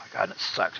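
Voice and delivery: usual speaking voice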